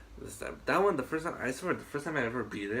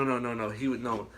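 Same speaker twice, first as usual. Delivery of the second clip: ordinary talk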